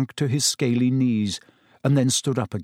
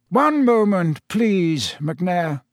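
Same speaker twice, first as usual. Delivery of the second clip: put-on higher, measured old headmaster; Dumbledore; 5 semitones higher, much slower than usual. A narrator's reading. Headmaster stalls the executioner, courteous and measured. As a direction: interrupt politely in a lifted voice. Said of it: "came Dumbledore's voice".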